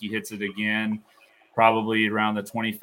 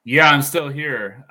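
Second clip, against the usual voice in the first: choppy, cutting-out line; radio breaking up; 4 semitones higher, 8 dB louder, slower than usual. Real, not acted. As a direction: voice dropping out on a bad connection. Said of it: "You're breaking up on me".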